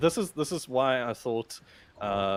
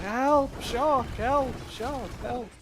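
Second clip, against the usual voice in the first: mock cries for help; 13 semitones higher, much slower than usual. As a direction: ham up a swimmer screaming for help. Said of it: "and then he's like".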